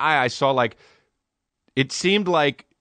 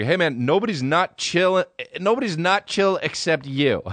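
higher-pitched mock group reply; playful put-on comeback to a drunk; imitated retort; 6 semitones higher than usual. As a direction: imitate friends teasing back in a raised pitch. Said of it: "and they're like".

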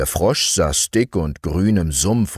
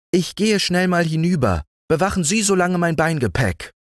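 high, fast, bustling ant voice; busy ant; 11 semitones higher, faster than usual. A narrator's reading. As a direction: hurry the words like a busy ant.

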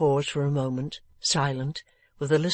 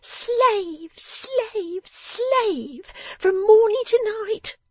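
very high wail, each "slave" set apart; scolding lament; 5 dB louder, much slower than usual. A narrator's reading. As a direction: lament your drudgery in a high, reproachful cry. Miss Wren scolding her drunken father.

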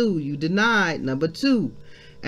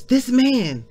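raised, loud accusation; accusing servant girl; 8 semitones higher, 4 dB louder than usual. Acted. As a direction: point him out to the bystanders. Acts the servant girl's accusation.

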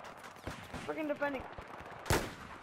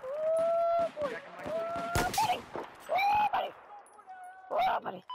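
squeaky high oh buddy; high mock voice. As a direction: go way up high and silly. Gamer reacting in high voice.

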